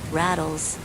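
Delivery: ordinary delivery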